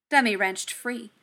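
ordinary delivery, nothing marked

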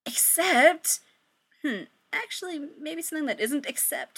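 ordinary delivery

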